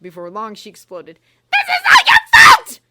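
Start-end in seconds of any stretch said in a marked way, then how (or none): 1.5-2.8 very high, very loud furious scream. A narrator's reading. Umbridge screaming at Ron in fury. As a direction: scream it at him with everything. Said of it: "she screamed".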